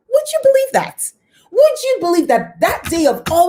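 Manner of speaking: sudden leap way up in pitch, astonished; a squeal of disbelief to the viewers; amazed. Real, not acted. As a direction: ask it in wide-eyed disbelief. Rhetorical astonishment at coincidence.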